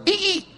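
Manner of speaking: squeaky high brake squeal; brake screech. Retold or imitated. Preacher imitating his car braking hard: high squeal. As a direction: mimic the car stopping short.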